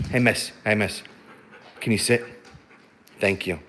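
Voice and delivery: calm her down gently; gentle dog talk. Real, not acted. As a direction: owner coaxing her husky to sit, softly. Owner calming husky into sitting.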